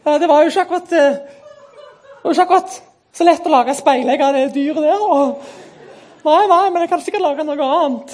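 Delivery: high, hesitant meek voice; gentle twin backing down politely; Jakob. Acted. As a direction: object timidly, pitched well up. Jakob answers Esau, earlier meek voice.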